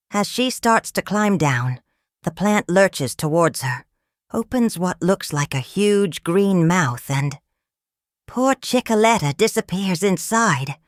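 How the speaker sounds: ordinary delivery, nothing marked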